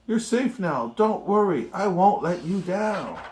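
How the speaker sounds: a hint of Horton calling up to the mayor; gentle mock call to a tiny friend; elephant calling back. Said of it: "Horton called back".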